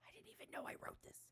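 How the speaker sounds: high, fast, whispered disbelief; squeaky whisper. Real, not acted. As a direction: breathe it out in embarrassed shock. Shocked at own old writing.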